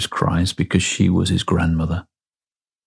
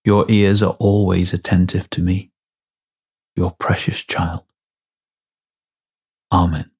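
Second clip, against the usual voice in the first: prayer. Acted. Leads a closing prayer.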